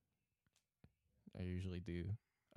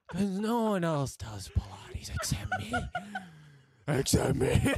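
laughing; 9 semitones higher, 8 dB louder than usual. Real, not acted. Laughing through a joke.

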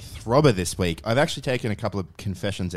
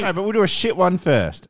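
loud retort; 7 semitones higher, 4 dB louder than usual. Real, not acted. Arguing back.

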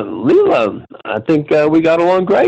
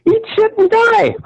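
put-on very high, squeaky child cursing; a sweet little girl swearing like a sailor; bratty little girl voice. Retold curse repeated.

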